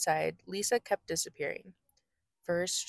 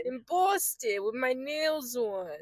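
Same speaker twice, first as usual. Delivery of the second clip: higher, drawn-out mock Boston accent; 5 semitones higher, slower than usual. Joking about her nails, slow hammed-up Boston accent. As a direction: stretch it out in a put-on Boston accent. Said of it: "go straight to Boston".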